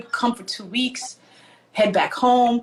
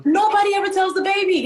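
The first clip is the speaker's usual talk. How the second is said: high, playful grievance; mock indignant protest; 10 semitones higher than usual. Youngest child airs her grievance, mock indignant protest. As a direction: complain as the overlooked baby of the family.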